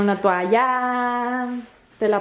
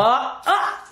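sharp yelp at cold water; startled yelp, real. Real, not acted.

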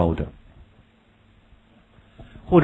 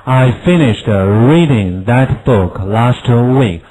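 slow, louder, every word spaced; slow model reading; 7 dB louder, much slower than usual. Shown as a demonstration. Teacher modelling the sentence, slow and louder. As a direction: read it slowly and clearly for the class. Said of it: "我们再读一下".

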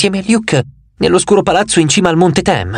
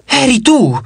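much higher, startled exclamation; a high, sharp cry of discovery; exclaimed; 9 semitones higher, slower than usual. A narrator's reading. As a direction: blurt it out in sudden realisation.

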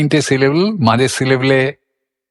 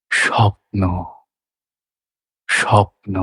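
dreamy feeling; 4 semitones lower, slower than usual. Shown as a demonstration. Teacher voicing the feeling of a dream to students. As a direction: breathe স্বপ্ন like a dream. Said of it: "দেখা যাক এবারে এখানে".

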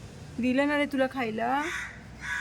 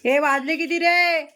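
loud, higher and slow; loud call; 5 semitones higher, 6 dB louder than usual. Real, not acted. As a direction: call across the room asking the time. Loudly asking the time. (मोठ्याने हाक मारत विचारणे)

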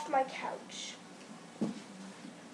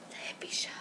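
whispered sign-off; 9 dB quieter, much faster than usual. Acted. Host signs off the episode in a whisper. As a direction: breathe the show's name softly.

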